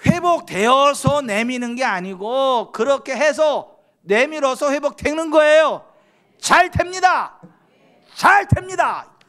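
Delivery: slow, high, booming proclamation; full-force preaching. Real, not acted. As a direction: stretch the words into a rousing declaration.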